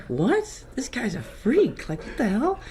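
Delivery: high-pitched mock disbelief; incredulous. Classmate hears the new guy's secret, incredulous. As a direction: imitate a baffled, scornful classmate.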